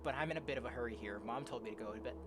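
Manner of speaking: low, soft, measured reading; story narration. Acted. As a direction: read the tale quietly and evenly. Reads game story aloud.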